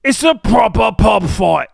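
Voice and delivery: loud hammed-up London pub brawler; mock British accent. Mock Brit picking a pub fight, loud mock accent. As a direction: shout it like a cartoon bloke squaring up.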